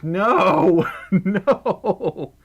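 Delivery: loud, drawn-out wailed "no"; hammed-up lament; mock dismay. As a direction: cry out in playful despair, laughing between. Oil spilled on phone, joking lament.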